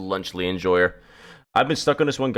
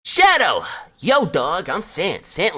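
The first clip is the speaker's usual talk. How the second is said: high, loud cartoon-skeleton call, easing off; put-on character voice, pitched up; Sans; 8 semitones higher, 4 dB louder, slower than usual. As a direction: open on a big shout, then introduce yourself in character. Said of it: "let me get the Sans voice".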